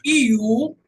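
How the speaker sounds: "iu" loud with a glide; loud "iu" sound; long u demo. Shown as a demonstration. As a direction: say "iu" as I sliding to U. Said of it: "Você tem o U de".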